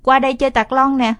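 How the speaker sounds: loud, high invitation; calling over. Acted. Boy calls his sister over to play, loud invitation. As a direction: wave her over across the yard.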